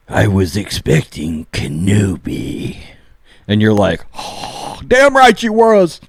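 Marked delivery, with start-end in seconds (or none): 0.1-2.8 low, slow, drawn-out villain voice; Darth Maul. Acted. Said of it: "all of a sudden you hear". 4.2-6.0 breathy "oh" bursting into high cheer; acted fan hype, pitch leaping up; fired-up fan. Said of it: "So you get all fired up".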